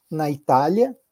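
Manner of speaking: ordinary delivery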